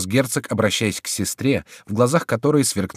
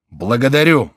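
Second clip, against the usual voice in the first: raised, loud cry of despair; 4 semitones higher, 8 dB louder than usual. A narrator's reading. King given until midnight, crying out in despair. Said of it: "вскричал король в припадке отчаяния".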